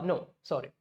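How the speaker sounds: ordinary talk, nothing marked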